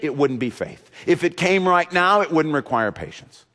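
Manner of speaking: ordinary talk, nothing marked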